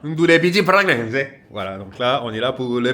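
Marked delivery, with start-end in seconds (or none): 0.0-1.2 loud, high, hammed-up Italian; Italian accent. Vlogger plays at Italian for the perfume fair, exuberant Italian accent. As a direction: proclaim it with a big Italian lilt. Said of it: "J'essaye de parler italien".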